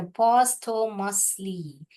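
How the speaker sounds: posthumously said very slowly, each syllable separate; slow syllable split. Shown as a demonstration. Teacher showing how to divide posthumously while reading. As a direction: divide the word as you say it. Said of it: "ऐसे divide कीजिए".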